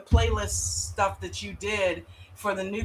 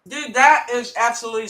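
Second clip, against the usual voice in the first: chewing while talking; 5 semitones higher, 8 dB louder than usual. Real, not acted. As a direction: mouth full of chips. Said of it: "sorry, I'm chewing on some Fritos".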